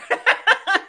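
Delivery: a burst of high real laughter; high delighted laugh. Real, not acted. Laughs at her own point.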